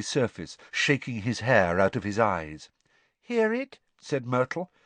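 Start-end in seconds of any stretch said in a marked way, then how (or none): 3.2-3.8 put-on high, airy ghost girl; high girlish ghost voice; Myrtle's ghostly voice. A narrator's reading. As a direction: speak like a glum schoolgirl ghost. Myrtle asks.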